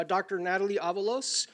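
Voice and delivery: ordinary delivery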